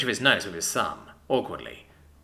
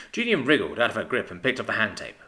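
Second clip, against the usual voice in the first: usual speaking voice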